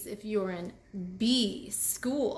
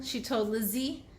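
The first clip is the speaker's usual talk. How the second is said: usual speaking voice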